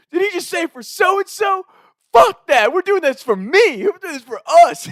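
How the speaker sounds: high, loud indignant shout; screaming back. Retold or imitated. Trey ranting to his teammate mid-race, loud shout. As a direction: shout it out mid-run, fired up. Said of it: "screaming back at our coach".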